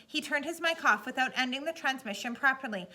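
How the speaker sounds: usual speaking voice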